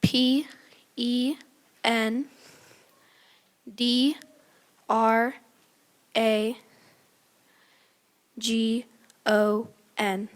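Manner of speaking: letter by letter with long pauses; spelling it out. Real, not acted. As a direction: spell each letter slowly and carefully.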